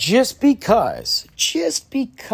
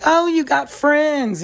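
mock mom voice; 11 semitones higher, slower than usual. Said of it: "And the mom's like".

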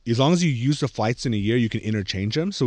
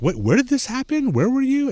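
much higher, startled question; alarmed kid; 13 semitones higher than usual. Retold or imitated. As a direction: ask like you can't believe it. Kids alarmed by her story.

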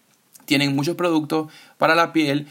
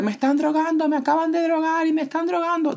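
very high-pitched mock shriek; a woman shrieking that she's been drugged, mocked in a high-pitched panic; retold hysterical scream; 14 semitones higher than usual. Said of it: "Empieza a gritar".

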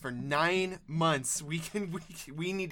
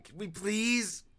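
slow pleading; 4 dB quieter, much slower than usual. Acted. Pregnant wife begging to leave.

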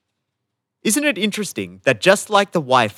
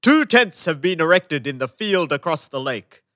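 booming royal herald calling out; town crier; 3 semitones higher than usual. Acted. Royal announcement read aloud.

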